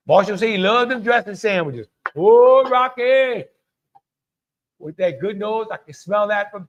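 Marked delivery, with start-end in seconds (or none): 2.2-3.4 excited greeting. Real, not acted. Greeting a viewer in chat.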